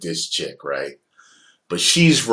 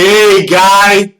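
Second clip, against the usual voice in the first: much higher, louder put-on voice for the greeting; odd greeting; 11 semitones higher, 12 dB louder than usual. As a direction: say it like a stranger's weird hello. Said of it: "It's not like".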